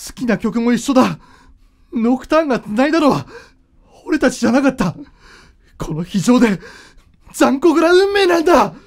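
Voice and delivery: despairing shout building to its peak; anguished outcry. Acted. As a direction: hurl the words at cruel fate. Son's anguished outburst at fate.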